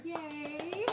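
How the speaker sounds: high little cheer; happy cheer. Real, not acted. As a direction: cheer for her. Repeat customer.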